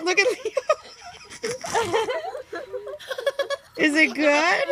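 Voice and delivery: high, helpless laughter; laughing. Real, not acted. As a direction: laugh through the words. Laughing at kids' faces.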